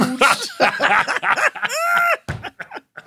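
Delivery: loud high-pitched burst of laughter; big high laugh. Real, not acted. Co-host cracking up at the song, loud laugh. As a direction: howl with laughter. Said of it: "That used to make me laugh so hard".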